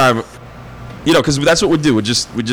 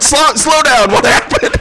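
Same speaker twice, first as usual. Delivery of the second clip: mock boss voice; 11 semitones higher, slower than usual. Owners' reply voiced.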